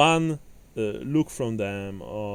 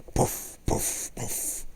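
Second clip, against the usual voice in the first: airy, unvoiced poofs; little blown puffs on a cigar; cigar puffing; 4 dB quieter, much slower than usual. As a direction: imitate short puffs of smoke.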